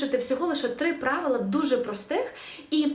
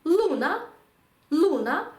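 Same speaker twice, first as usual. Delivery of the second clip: «Луна» modelled as LU-na, pitched up; penultimate stress; 6 semitones higher, 4 dB louder, slower than usual. Shown as a demonstration. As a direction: stress the first syllable of «Луна». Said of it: "ставимо наголос на попередній склад".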